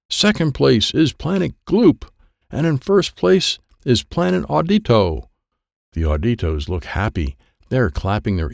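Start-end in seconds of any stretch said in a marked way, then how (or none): 0.1-5.3 announcer. Acted. Driver Doubleman on stage reading the race scores, announcing. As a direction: announce it grandly to a crowd.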